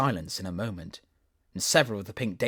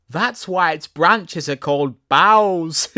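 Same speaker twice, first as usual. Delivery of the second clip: cheeky daisy; 8 semitones higher, 13 dB louder, slower than usual. Acted. Daisy explains joke.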